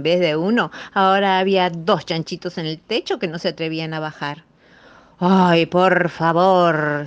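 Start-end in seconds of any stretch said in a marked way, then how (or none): 5.2-7.1 slow, louder weary groan; exasperated complaint. Acted. The eldest brother complaining about rescuing them again. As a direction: sigh it out, fed up. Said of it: "se quejó el mayor".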